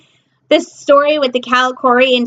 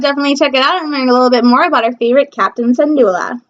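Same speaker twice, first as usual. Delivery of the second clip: ordinary talk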